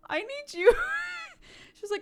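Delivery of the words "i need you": high, loud child imitation; mock tantrum voice, pitched way up; upset little kid. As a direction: yell like a demanding small child. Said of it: "like, a little kid".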